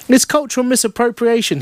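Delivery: high-pitched pedantic spiel; lecturing customer. A narrator's reading. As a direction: launch into a know-it-all lecture. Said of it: "he launches into a whole spiel".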